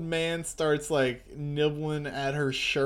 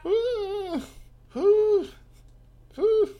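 put-on baby cooing; 6 dB louder, much slower than usual. Voicing the baby.

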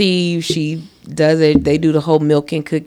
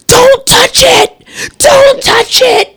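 put-on much higher, slow, louder kid cry; imitating panicked child; 4 dB louder, much slower than usual. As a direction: shriek it like a scared five-year-old. Imitating nephew yelling.

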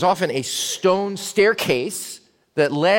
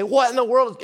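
mock crowd yell; 6 semitones higher than usual. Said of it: "people are starting to yell".

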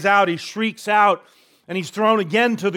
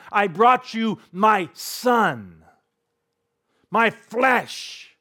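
pleading father; 5 semitones higher, much slower than usual. Acted. Father pleading for his son.

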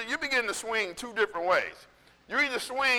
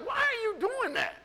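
baffled wife; 11 semitones higher, 4 dB quieter than usual. Retold or imitated. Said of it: "Courtney's like".

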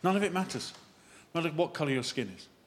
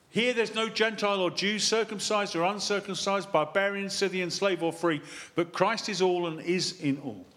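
raised, declamatory Bible reading; scripture reading; 7 semitones higher, 4 dB louder than usual. Real, not acted. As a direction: proclaim the verse to the congregation, higher in pitch.

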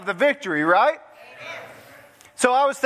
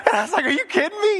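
laughing; 10 semitones higher, faster than usual. Real, not acted. Laughing at wife's snakebite story.

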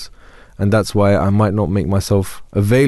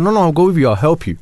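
urgent reassurance; 7 semitones higher than usual. Retold or imitated. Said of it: "I said".

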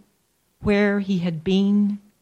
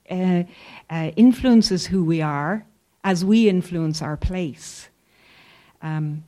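ordinary talk, nothing marked

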